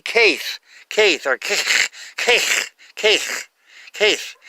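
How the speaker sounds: "Kaith" ending in a raspy German ch, as in Bach; guttural CH demo. Shown as a demonstration. Teacher tries out the Hebrew letter, "Kaith" with guttural ch. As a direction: practise "Kaith" in separate tries, scraping the final sound in the throat. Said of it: "like a German, uh, guttural sound".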